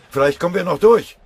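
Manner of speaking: urgent shout, high and very loud; urging. A narrator's reading. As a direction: yell it to push comrades on. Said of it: "drängte Pfennigbauer". (drängend und laut gerufen)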